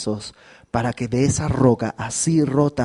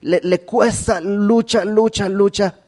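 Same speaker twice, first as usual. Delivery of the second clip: each "lucha" higher and pressed; building emphasis; 7 semitones higher, slower than usual. Acted. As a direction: hammer "lucha" three times. Struggle of Jacob, building. ("lucha" repetido con énfasis creciente)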